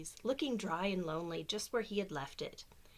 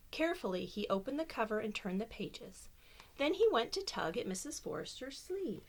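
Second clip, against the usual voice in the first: lively storybook reading voice; reading to children; 4 semitones higher than usual. Acted. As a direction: read it like bedtime story time. Reads picture book aloud.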